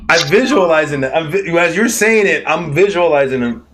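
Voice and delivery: usual speaking voice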